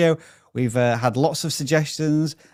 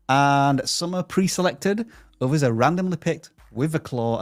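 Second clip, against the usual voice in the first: ordinary talk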